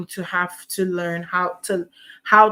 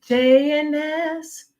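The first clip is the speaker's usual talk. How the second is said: announcer; 8 semitones higher, slower than usual. Acted. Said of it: "joining the".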